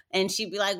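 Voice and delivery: ordinary talk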